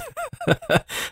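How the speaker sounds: a quick self-mocking laugh, up high; short laugh. Real, not acted. Host laughs at himself after calling the show egotistical, a brief high laugh. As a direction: laugh at your own self-centred show.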